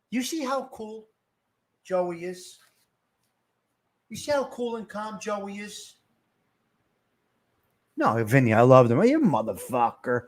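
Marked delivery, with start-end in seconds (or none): 0.1-6.0 much softer, measured delivery; hushed, cool aside. Real, not acted. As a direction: drop right down, leaving pauses. Contrasts Joey's calm with others.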